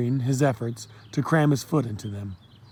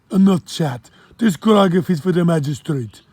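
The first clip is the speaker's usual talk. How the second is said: louder, higher Jamaican order; brisk Jamaican command; Jamaican accent; 4 semitones higher, 6 dB louder than usual. Acted. Kofi orders the boy to carry grog, Jamaican accent. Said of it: "heavy accent".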